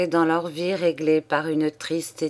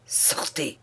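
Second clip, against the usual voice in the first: cri soufflé, étranglé et sans voix; étouffe le cri dans la rage; voix étranglée de fureur; 4 semitones lower, 8 dB quieter than usual. A narrator's reading. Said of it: "cria-t-il d'une voix étranglée par la fureur". (le comte chasse son fils, la gorge serrée de fureur)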